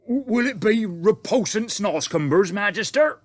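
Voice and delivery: loud, high giant asking with a stumble; worried giant question. A narrator's reading. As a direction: fret over the menu like a nervous giant. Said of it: "the B F G asked".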